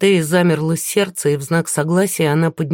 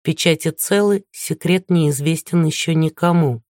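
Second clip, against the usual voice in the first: usual speaking voice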